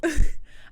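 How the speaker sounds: loud laugh; burst of laughter. Real, not acted. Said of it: "I'm sorry".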